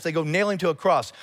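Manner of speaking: ordinary talk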